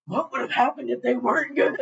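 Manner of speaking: high-pitched, laughing outburst; incredulous reaction. Retold or imitated. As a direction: echo it in a high, giggly squeal. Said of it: "I'm just like".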